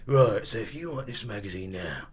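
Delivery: put-on soft, menacing old geezer; gangster geezer. Gangster geezer selling a magazine by phone, quiet menace. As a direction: quietly lean on them like a gangster. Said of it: "these old kind of gangster geezers".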